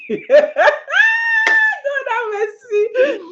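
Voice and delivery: exasperated laughing plea to God; half-laughing cry of disbelief; laughing exclamation. Real, not acted. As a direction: laugh then cry out high and slow.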